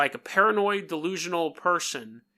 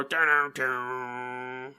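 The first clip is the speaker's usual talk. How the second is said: slow low hummed riff imitating an electric guitar; dun-dun it like a rock riff; 3 semitones lower, much slower than usual.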